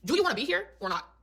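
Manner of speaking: retold confrontation. Fed-up friend to the man texting at dinner, confrontational. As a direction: put it to him as a pointed question.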